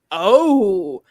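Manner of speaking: loud, drawn-out oh of realization; loud imitated "oh" as it clicks; sudden realization. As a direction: retell a big dawning oh. Retold sudden realization at book's ending.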